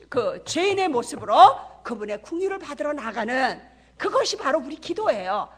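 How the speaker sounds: ordinary talk, nothing marked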